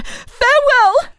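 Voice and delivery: slow, high, ringing farewell; parting cry. Acted. Marie's last farewell to Antoine, slow and high. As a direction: let it hang as she leaves.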